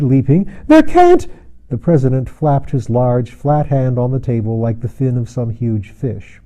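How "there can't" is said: a very high, loud cry of disbelief. A narrator's reading. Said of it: "he cried".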